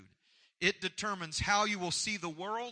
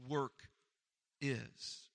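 usual speaking voice

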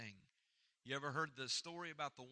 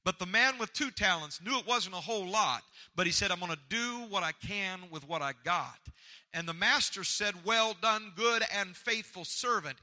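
preaching; 4 semitones higher, 9 dB louder than usual. Real, not acted. Preacher building to full force.

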